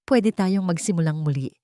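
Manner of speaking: ordinary delivery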